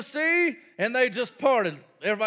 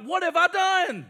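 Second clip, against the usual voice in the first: dismayed Pharaoh; 6 semitones higher, 3 dB louder than usual. Acted. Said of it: "He's like".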